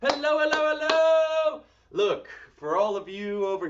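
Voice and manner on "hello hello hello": high, loud sing-song hello; excited greeting. Acted. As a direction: call out hello cheerfully. Greeting joiners in a put-on voice.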